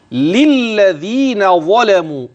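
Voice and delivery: "للذين ظلموا" chanted slowly and high; slow tajweed chant; recitation. Shown as a demonstration. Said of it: "إن لم ترقق".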